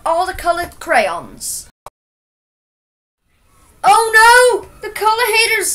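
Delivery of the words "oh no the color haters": high, loud alarmed cry; high-pitched shout of dismay; acted scream. A narrator's reading. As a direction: scream it out in story-book alarm. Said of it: "screamed Joseph".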